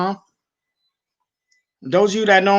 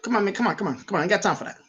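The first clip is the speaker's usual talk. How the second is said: softer, waving them off; dismissive brush-off; 7 dB quieter, much faster than usual. Retold or imitated. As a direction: brush him off, quietly dismissive. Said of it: "I'm like".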